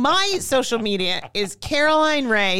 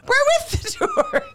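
much higher sing-song mock; mocking the guide. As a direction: pipe it up high, teasing the guide. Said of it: "Kim and I are like".

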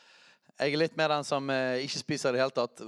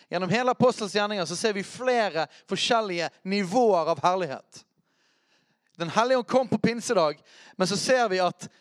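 higher, emphatic sermon delivery; fervent preaching; 7 semitones higher, 5 dB louder than usual. Real, not acted. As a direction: drive the teaching home with rising fervour. Preaching at raised intensity.

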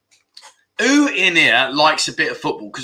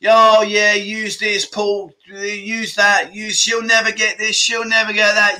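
mocking the viewers; 6 semitones higher, slower than usual. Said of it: "you were giving me all kinds of things".

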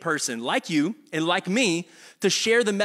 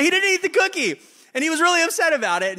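high, louder exasperated mom; indignant mom; 11 semitones higher, 5 dB louder than usual. Retold or imitated. Mom protests to dad about the cookie, high outrage.

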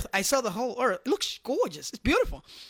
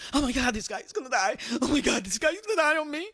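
imitating a terrified nurse; 3 semitones higher, faster than usual. Nurse rushing to the waking patient, mock terrified and fast. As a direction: rattle it off like a frightened nurse who thinks he's dying. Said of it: "And she terrified".

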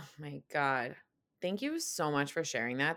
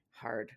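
"hard" set apart by a pause, lower and softer; emphasis on HARD; 4 semitones lower, 7 dB quieter than usual. Real, not acted. Praising someone for sitting with emotions, weighty stress on "hard". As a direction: land "hard" alone, dropped low after a beat. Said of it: "Emphasis on hard".

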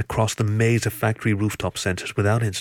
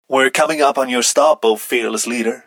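filtered comlink voice, raised and loud; loud, higher voice over a comms link; electronic message; 4 semitones higher, 6 dB louder than usual. A narrator's reading. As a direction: call it out like a radio message. Teammate's electronic message read out.

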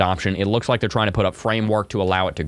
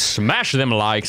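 slow, drawn-out "Smash them likes"; mock tough drawl; much slower than usual. Echoing the viewer's chat as a hammed-up hype man, slow and drawn out. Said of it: "Smash the likes".

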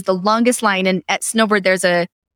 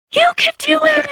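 encouraging call. Retold or imitated. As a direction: cheer her on from far below. Calling encouragement up to jumper.

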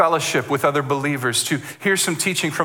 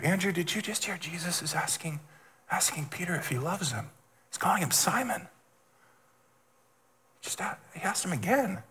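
much softer hushed aside; whispered aside; 3 semitones higher, 11 dB quieter than usual. Acted. John murmuring to Andrew beside the fire, hushed. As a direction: lean in and murmur it secretly.

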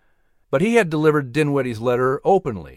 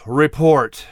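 exhausted; 3 dB louder than usual. Acted. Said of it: "demanded in an exhausted voice".

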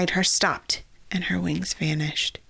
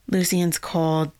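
ordinary delivery

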